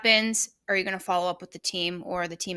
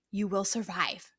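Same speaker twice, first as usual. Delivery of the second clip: usual speaking voice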